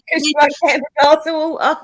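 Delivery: high-pitched words through giggles; laughing. Real, not acted. Teasing about a glass of water, laughing. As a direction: barely hold the laugh while speaking.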